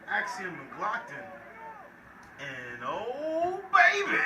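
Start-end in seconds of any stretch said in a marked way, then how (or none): 2.9-4.2 sudden high excited yell. Real, not acted. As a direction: scream it with joy. Big hit excites announcer.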